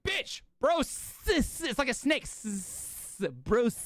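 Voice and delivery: "Bros" ending in a long hissed S like a snake; hissing S on Bros. Retold or imitated. Him snapping back at the coach, hissing S. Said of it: "I'm like".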